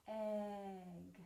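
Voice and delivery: soft, stretched "Egg"; "Egg" drawn out slowly for learners; really slow. Shown as a demonstration. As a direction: hold the vowel of "Egg" before the g. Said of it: "Let's say it really slowly".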